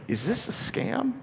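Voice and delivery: high-pitched, drawn-out mock suspicion; incredulous question. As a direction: squeak it slowly like a doubtful debtor. Debtor doubts the free pardon.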